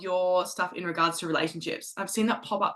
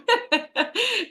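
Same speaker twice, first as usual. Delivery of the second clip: high delighted laugh. Real, not acted. Delighted laugh after echo.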